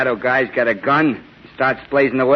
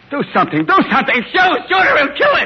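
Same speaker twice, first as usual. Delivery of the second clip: rising terrified yell, higher and louder; panicked shout; 13 semitones higher, 5 dB louder than usual. Acted. Henchman begging Spike to shoot the Shadow, panicked shout. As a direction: scream for him to shoot.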